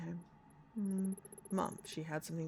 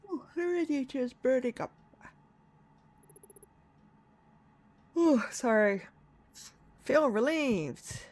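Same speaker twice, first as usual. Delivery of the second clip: relieved character voice; 6 semitones higher, 10 dB louder than usual. Acted. Voicing character by warm radiator.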